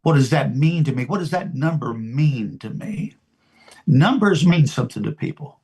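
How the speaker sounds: normal talking voice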